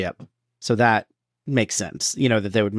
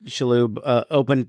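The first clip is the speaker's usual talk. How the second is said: ordinary delivery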